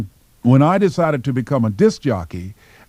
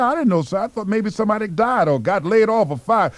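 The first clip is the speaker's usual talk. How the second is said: high, innocent excuse; a hopeful kid feigning innocence; naive young applicant; 8 semitones higher than usual. Retold or imitated. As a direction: play dumb sweetly. Eager applicant keeps asking.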